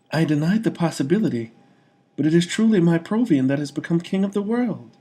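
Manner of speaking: high, loud happy lady's voice; amazed and glowing with pride; delighted young woman. A narrator's reading. Woman marvels at her love becoming king.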